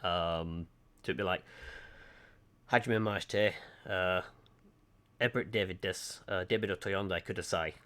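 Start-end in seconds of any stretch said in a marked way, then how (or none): 2.7-7.7 Japanese said in a Lancashire accent, flat English vowels. Shown as a demonstration. Showing viewers his Lancashire-accented Japanese. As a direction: say "Hajime maste" with a Lancashire accent. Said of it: "as a Lancashire person in Japanese".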